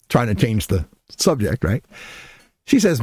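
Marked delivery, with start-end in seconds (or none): none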